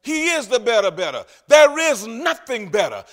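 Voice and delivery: loud, climbing sermon cadence; full-force preaching. Acted. Preacher building to a peak before his church, loudly. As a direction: hammer each line home to the congregation.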